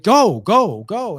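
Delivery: high, loud push to move; urgent coach. Retold or imitated. Coach to a tired swimmer at the pool, urgent. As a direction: imitate a coach driving a swimmer on.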